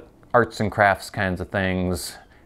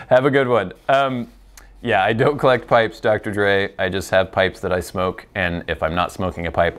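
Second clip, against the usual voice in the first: ordinary talk, nothing marked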